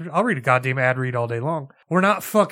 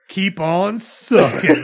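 Enzo catchphrase; 2 semitones higher, 7 dB louder, much slower than usual. Retold or imitated. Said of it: "you made Enzo say".

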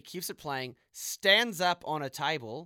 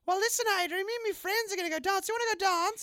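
much higher put-on woman's voice; flirty woman Abatha; 5 dB louder than usual.

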